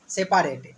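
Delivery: "सेपरेट" in three beats, "से-पा-रेट"; spelling pronunciation "सेपारेट"; said as written. Shown as a demonstration. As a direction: sound a full "पा" in the middle, "सेपारेट". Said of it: "क्या पढ़िएगा?".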